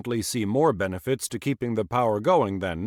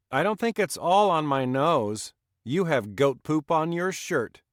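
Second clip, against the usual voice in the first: put-on young son's voice; 7 semitones higher, 3 dB louder than usual. Son teasing his father back.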